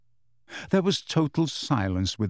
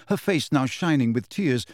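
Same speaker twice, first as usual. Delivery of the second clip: ordinary delivery, nothing marked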